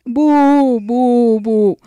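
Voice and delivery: long drawn-out boos, stepping down in pitch; mock booing. Host booing listener's requested song, drawn-out mock boos. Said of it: "Abucheos para la Moni".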